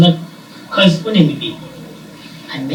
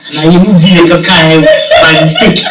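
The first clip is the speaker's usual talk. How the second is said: laughing while talking; 3 semitones higher, 12 dB louder than usual. Real, not acted. Storyteller confesses a mistake to host, laughing loudly. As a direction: crack up loudly while recounting it.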